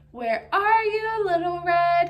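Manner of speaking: slow, high singsong call. A narrator's reading. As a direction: call it out loud and drawn-out, teasingly. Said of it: "hear the wolf calling to her".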